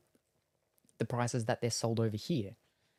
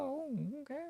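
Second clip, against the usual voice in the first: quiet self-quote; 13 semitones higher, 8 dB quieter than usual. Retold or imitated. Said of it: "And I was like".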